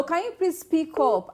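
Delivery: calling out. Real, not acted. Host asking a faint caller to speak up, calling out. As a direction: project down a bad phone line.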